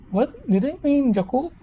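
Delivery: high-pitched incredulous question; baffled surprise. Retold or imitated. Reacting to hearing Yakult said differently, baffled surprise. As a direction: pitch it way up, puzzled. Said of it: "I was like".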